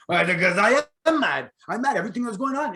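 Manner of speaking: high-pitched mock French Canadian accent. Interviewee answering why they call him that, mimicked French Canadian accent. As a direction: imitate a French Canadian politician, pitched well up. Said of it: "I love to use his accent 'cause I love the French accent, French Canadian accent".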